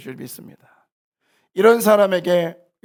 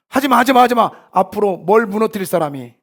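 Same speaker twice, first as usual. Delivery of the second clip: quick, raised, louder telling-off; mock scolding; 4 semitones higher, 5 dB louder than usual. Pastor replaying childhood scolding on his congregation, mock scolding. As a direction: imitate a nagging adult, higher and louder.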